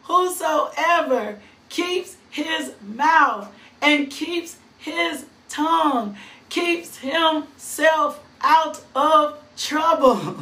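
each word laid down slowly; slow it right down so it sinks in; slow emphatic reread. Shown as a demonstration. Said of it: "I'ma say it one more time".

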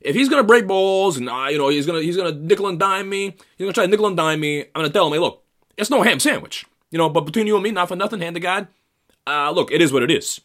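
high, loud New York sales guy rant; mocking a Metro North sales dude. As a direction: play a schmoozing sales bro swapping war stories. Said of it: "They're just like".

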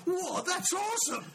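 high, slow, laughing amazement; mock-gasp it like you just saw the coolest shot; amazed whoa. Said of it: "Nathan's like".

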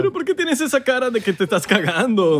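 hammed-up bewilderment; odd put-on skit voice. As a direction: ask it in a goofy, stilted skit voice. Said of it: "estabas hablando como raro".

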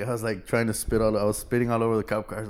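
usual speaking voice

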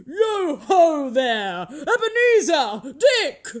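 loud, drawn-out hearty holler; high, booming jovial summons; rich, jovial call. A narrator's reading. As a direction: call out in a fat, oily, comfortable voice. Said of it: "called out in a comfortable, oily, rich, fat, jovial voice".

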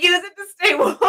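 high, loud, through laughter; laughing mock advice. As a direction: crack up while quoting. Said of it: "They're like".

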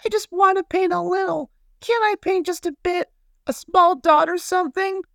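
very high, tiny character voice; pleading cry. A narrator's reading. Little paintbrush begs the big brushes to paint, pleading high. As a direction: beg in a little squeak. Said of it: "cried the little paintbrush".

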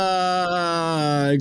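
long, level drawn-out groan; real pun groan. Real, not acted. Friend hearing an awful pun, long groan. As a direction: groan at a terrible punchline.

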